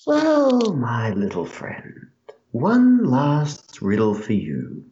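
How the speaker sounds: slow, teasing riddler voice that ends in a breathy hush; riddling voice. Acted. Riddler in the hero's head poses a riddle, slow and teasing. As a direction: stretch out the riddle like a sly mysterious voice. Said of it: "You hear this voice once more".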